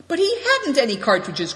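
crying out. A narrator's reading. The elder protesting that the thief had no cartridges, crying out. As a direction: blurt it out in disbelief. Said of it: "cried Good Fortune".

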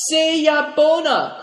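high, slow, loud call; loud cry. A narrator's reading. Dombit calls after the departing riders, loud. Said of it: "cried Dambit".

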